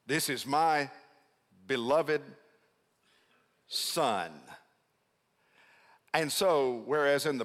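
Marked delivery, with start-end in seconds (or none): 0.1-4.4 "Son" alone after a pause, dropped in pitch; stress on Son. Shown as a demonstration. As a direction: set "Son" apart as the key word. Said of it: "is the word Son".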